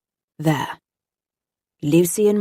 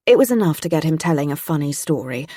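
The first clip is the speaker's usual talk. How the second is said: usual speaking voice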